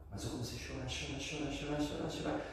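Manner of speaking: soft, rising run of repeated words; quiet, piling-up repetition; hushed repetition. Retold or imitated. Hushed repetition describing endless crying.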